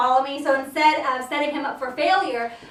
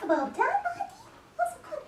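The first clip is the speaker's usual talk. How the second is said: higher and softer, gentle praise; 8 dB quieter than usual. Real, not acted. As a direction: float it up quietly to the pup. Praising the dog softly.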